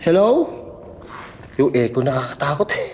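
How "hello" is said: high, loud call into the dark; calling out loudly. Real, not acted. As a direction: shout Hello? up high to hear the echo. Calling louder into cave hole.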